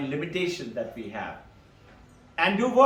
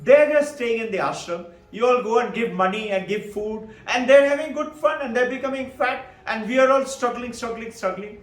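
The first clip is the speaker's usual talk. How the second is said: raised, louder protest of a retold child; indignant complaint; 5 semitones higher, 4 dB louder than usual. Child complaining to mother about the monks, indignant. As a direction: argue it hotly, pitched up.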